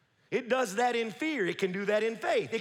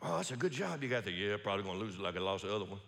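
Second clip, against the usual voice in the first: gloomy mutter; 13 semitones lower, 7 dB quieter, faster than usual. Retold or imitated. Voiced negative exchange.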